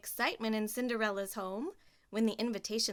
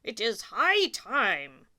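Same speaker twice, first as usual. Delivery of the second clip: louder, slow royal voice; king; 10 dB louder, slower than usual. Acted. King to the Grand Duke, slow and loud. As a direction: proclaim it like a king. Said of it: "said the king".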